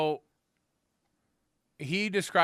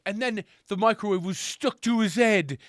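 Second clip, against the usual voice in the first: light imitation of a video narrator, higher; mock narration; 4 semitones higher than usual. Host mimicking the pranksters narrating their microwave stunt, mock narration. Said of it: "then they narrate it like".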